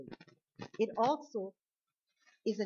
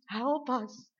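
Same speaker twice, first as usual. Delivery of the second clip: retold plea, higher and louder; mock scream; 5 semitones higher, 7 dB louder than usual. Moses trapped by the sea, mock scream for help. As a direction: dramatise a desperate call for help. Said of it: "he screams".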